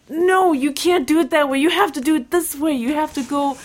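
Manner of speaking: high-pitched mock-exasperated coaching; fussy, hammed-up insistence; insistent correcting. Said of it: "and I'd be like".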